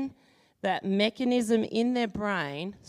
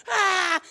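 panicked cry; 10 semitones higher, 7 dB louder than usual. Retold or imitated. Kid panics.

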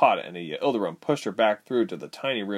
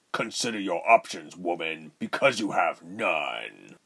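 frowning menace; 3 semitones lower than usual. A narrator's reading. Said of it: "Rubens said, frowning".

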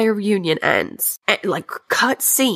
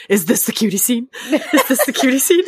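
rising, higher-pitched question with a laugh; giddy anticipation; 6 semitones higher than usual. Retold or imitated. Viewer waiting for the cutie scene, acted giddy impatience. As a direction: ask it again and again, mounting excitement.

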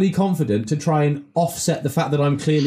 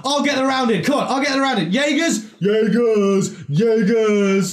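high, brash lad shouting rounds; cocky teen at the bar; 4 semitones higher than usual. Retold or imitated. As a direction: play an overconfident underage lad buying drinks. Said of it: "who isn't going".

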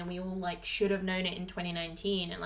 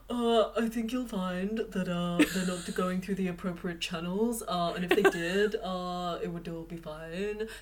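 low, unhurried character voice played for laughs; John Kane impression; 3 semitones lower, 3 dB quieter than usual. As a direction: draw it out with hesitant uhs, sinking low. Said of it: "can you do a John Cain?".

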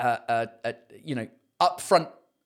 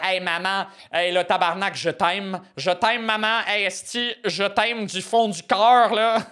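higher-pitched mock Quebecois daughter; Quebecois accent; 4 semitones higher, 3 dB louder than usual. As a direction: exaggerate a Quebecois French accent as a child gushing to mum. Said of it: "in a Quebecois accent".

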